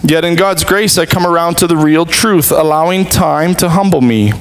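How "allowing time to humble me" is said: normal talking voice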